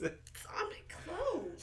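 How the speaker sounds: soft unvoiced whisper. Real, not acted. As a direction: breathe it out as a secret. Whispered aside after spilling drink.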